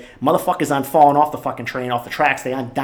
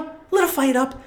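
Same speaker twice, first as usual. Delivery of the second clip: much higher, mocking his own words in a silly voice; squeaky mock voice; 9 semitones higher than usual. Host teasing himself about being fired up, squeaky mock voice. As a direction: parrot it back in a squeaky put-on voice. Mocks himself after admitting anger.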